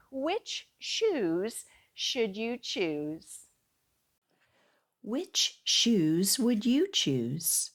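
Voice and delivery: title announcement. Acted. Announcing the last book title.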